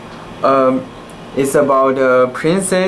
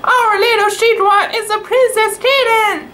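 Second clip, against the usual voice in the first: much higher, fast delighted squeal; overjoyed; faster than usual. A narrator's reading. Tai Tai announcing the princess kitten, fast high delight. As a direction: burst out with joy.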